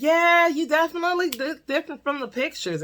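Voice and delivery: sweetly sarcastic brush-off; mock polite sarcasm. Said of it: "And I'm just like".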